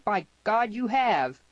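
angry accusation. A narrator's reading. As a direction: hurl it as an indignant accusation.

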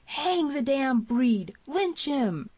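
high, drawn-out yell; mob shout. A narrator's reading. Man at back of crowd urges a lynching, shouting. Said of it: "There was a shout from the rear".

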